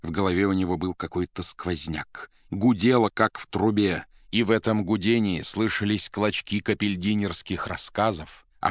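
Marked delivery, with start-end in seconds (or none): none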